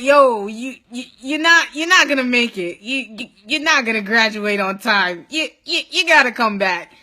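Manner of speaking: lightly mocked stammering delivery; mocking stammered verdict. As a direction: mimic them stumbling through bad news. Mimicking staff breaking bad news.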